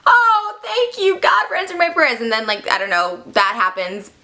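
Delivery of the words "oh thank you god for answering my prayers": high, hammed-up thanks to heaven; overjoyed hermit. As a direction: gush with theatrical gratitude. Blind man thanking God.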